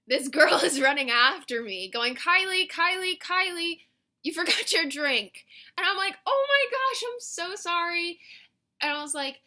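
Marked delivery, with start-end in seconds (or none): 2.2-5.5 high, loud imitation of urgent calling; calling after someone. Server chasing a customer who forgot a drink, urgent calling. 6.3-8.2 very high, gushing mock apology; flustered apology. Customer apologizing to server for forgotten drink, flustered and high.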